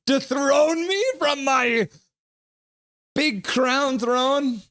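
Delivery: a hammed-up king's voice; mock villain voice. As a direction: put on a mock tyrant defending his throne. Mock challenge in a put-on voice.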